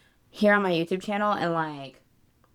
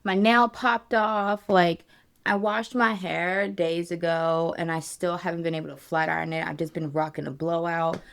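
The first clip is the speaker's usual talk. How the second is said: ordinary talk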